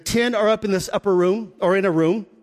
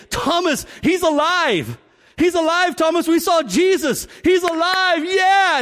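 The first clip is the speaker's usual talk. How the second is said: excited shouting; 7 semitones higher than usual. Retold or imitated. Ten disciples telling Thomas Jesus is alive, excited and high. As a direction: burst out that he's alive. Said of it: "all of them say".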